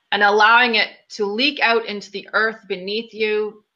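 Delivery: unhurried guiding voice with long pauses between phrases; guided meditation. Acted. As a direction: lead a group through breathing and visualization, leaving room after each phrase. Leads guided meditation after plain intro.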